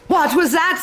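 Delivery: ordinary talk, nothing marked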